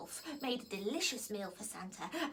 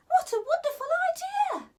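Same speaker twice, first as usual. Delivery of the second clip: high, loud, breathless delight; gasped delight; 10 dB louder than usual. Acted. Holly delighted by Ivy's idea, high and loud. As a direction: burst out with thrilled surprise. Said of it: "gasped Holly".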